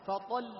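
فطل loud with a short pressed lam; pressed double lam. Shown as a demonstration. Teacher contrasts فطل with المزمل for students. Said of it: "لاحظ".

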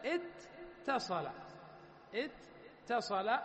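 اتصل drawn out very slowly, the doubled ت stressed; slow demo pronunciation. Shown as a demonstration. Teacher to students, slow careful اتصل. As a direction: spell out اتصل syllable by syllable. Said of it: "مثل ما أنط-، أنطقها أنا".

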